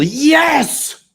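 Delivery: high, loud burst of joy; excited yes. Real, not acted. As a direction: shout it out in delight. Excited reaction to announcement.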